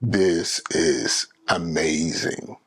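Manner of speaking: low, slow retold gasp of awe; amazed whoa. Retelling to viewers her jaw-dropping reaction, slow and awed. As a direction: let it drop low and stretch out in wonder. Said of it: "and I was like".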